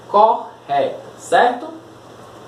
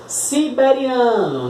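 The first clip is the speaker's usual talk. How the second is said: "Siberiano" slower and higher, SI-BE-RI-A-NO; slow enunciation; 5 semitones higher, slower than usual. Shown as a demonstration. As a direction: spell out the sounds of "Siberiano" for counting. Palavra para contar sílabas. ("Siberiano" devagar, bem articulado)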